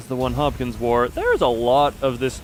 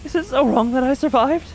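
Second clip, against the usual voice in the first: high, strained anguish; anguished cry; 12 semitones higher, 3 dB louder than usual. Acted. Yuri cries out her guilt at leaving them, high. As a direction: plead against the guilt. Voicing Yuri's anguished defence.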